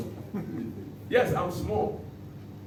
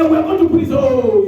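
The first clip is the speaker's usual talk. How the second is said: shouted defiance; 8 semitones higher, 9 dB louder than usual. Acted. Acting the boys' defiant reply.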